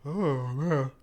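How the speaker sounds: ordinary talk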